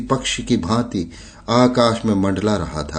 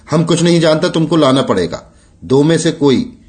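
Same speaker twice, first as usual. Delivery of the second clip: higher-pitched, insistent command; lightly acted overbearing boss giving orders; stern order; 6 semitones higher, 4 dB louder than usual. A narrator's reading. Sahib orders Mangru to bring a woman, stern and insistent.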